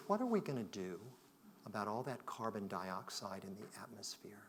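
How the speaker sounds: 1 hushed question. Retold or imitated.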